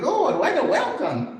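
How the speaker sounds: high-pitched, laughing disbelief; amused mock dismay; laughing exclamation. Wry reaction to the retold ordeal.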